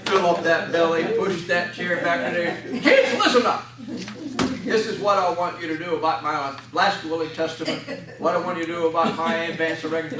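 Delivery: put-on loud holiday announcement to the kids; grandpa calling out. Grandpa at Thanksgiving telling the kids to listen, booming.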